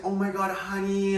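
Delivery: imitating a delighted mom, high-pitched; extremely happy mom. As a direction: gush it high, as a thrilled mother would. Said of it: "extremely happy".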